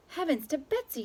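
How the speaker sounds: high surprised exclamation; exclaimed. A narrator's reading. The waitress exclaims at the cat, surprised. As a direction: gasp it out in surprise. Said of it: "she exclaimed".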